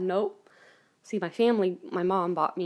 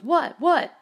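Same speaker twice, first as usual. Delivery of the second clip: startled mom; 5 semitones higher, 7 dB louder than usual. Retold or imitated. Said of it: "And Mom goes".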